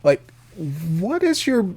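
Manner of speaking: normal talking voice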